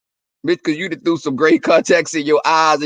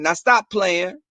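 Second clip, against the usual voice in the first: ordinary talk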